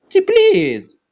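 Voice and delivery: high, pleading please. Real, not acted. Player begging the spin for a win, high squeaky please.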